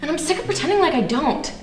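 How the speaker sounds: fed-up outburst, rising in pitch; indignant, mounting frustration; so mad. Acted. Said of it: "it makes me so mad".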